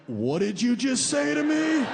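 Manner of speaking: high, indignant mock outrage; mock-offended date demanding an answer. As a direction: act shocked and offended. Date outraged at carrot cake.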